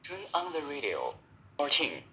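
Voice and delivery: small recorded device voice; the walkie's built-in announcement; voice prompt. Real, not acted. Radio's voice prompt.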